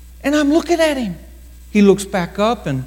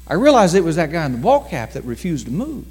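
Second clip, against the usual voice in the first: normal talking voice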